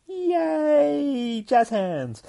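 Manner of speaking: high, loud cheering squeal; mock celebration. Real, not acted. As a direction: cheer sarcastically. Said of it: "Today we learned".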